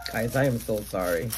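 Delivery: low, subdued apology, choked up; emotional. Real, not acted. Apologizing to viewers for getting emotional, subdued. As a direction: say it softly, overcome with feeling. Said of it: "I get very emotional".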